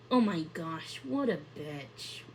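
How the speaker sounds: a real reader's hushed remark about the character; muttered aside. Real, not acted. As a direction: mutter it to yourself, half-laughing.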